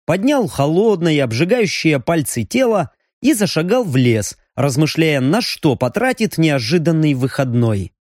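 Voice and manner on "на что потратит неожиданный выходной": ordinary delivery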